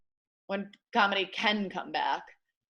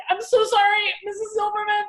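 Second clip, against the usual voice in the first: high, loud frantic apology; panicked apology; 12 semitones higher, 7 dB louder than usual. Retold or imitated. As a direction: beg forgiveness in a frenzy.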